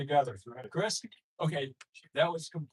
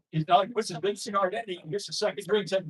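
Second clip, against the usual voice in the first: usual speaking voice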